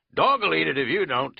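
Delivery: loud pushy country waiter; insistent host. Acted. Keith urging the hikers to eat, loud and insistent. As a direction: push the food on them.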